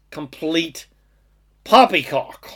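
put-on light English accent on the word. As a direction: say it like a proper Brit. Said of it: "Gotta say that with an English accent".

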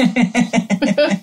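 giggle. Real, not acted. Giggling at anus mix-up.

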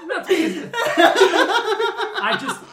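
slow words pushed through laughter; laughing. Real, not acted. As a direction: laugh helplessly while you speak. Laughing while complaining.

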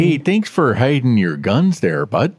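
chummy mock-Minnesota cop; Minnesota accent. Officer thanking the shooter, mock Minnesota accent. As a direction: lay on the Minnesota vowels. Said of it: "the most Minnesota-sounding accent".

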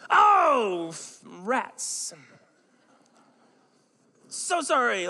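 high, loud alarmed oh then apology; sudden loud realisation; startled oops. Retold or imitated. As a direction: caught out, yelp and apologize. Caught forgetting wife's drink.

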